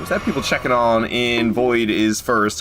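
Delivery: usual speaking voice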